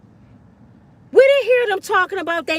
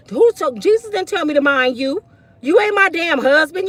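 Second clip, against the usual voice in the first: usual speaking voice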